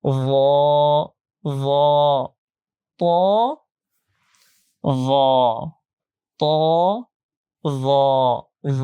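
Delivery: "র" and "ত" held with full rounded o; thick, heavy pronunciation. Shown as a demonstration. Teacher drills thick letters for students, heavy pronunciation. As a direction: make each "র" and "ত" long and heavy. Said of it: "মোটা করে পড়তে হবে".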